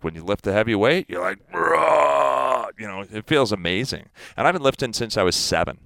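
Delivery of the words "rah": Hulk roar. Retold or imitated. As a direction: let out a mock Hulk roar. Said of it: "it's like the green stuff from the Hulk".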